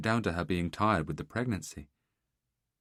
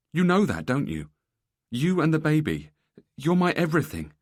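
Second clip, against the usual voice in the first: higher and louder, begging her to believe; desperate pleading; 9 semitones higher, 8 dB louder than usual. Acted. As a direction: pour your heart out, pleading. Husband pleads with crying wife.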